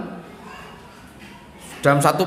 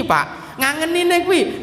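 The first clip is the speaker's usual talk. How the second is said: usual speaking voice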